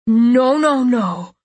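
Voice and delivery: loud, high cry of protest; distressed cry. A narrator's reading. Nico seeing the rescuers arrive, crying out. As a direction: cry out in dismay. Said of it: "Nico cried".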